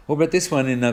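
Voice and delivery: normal talking voice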